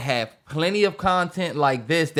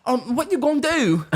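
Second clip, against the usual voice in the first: mock British accent; 9 semitones higher than usual. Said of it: "talking in a British accent".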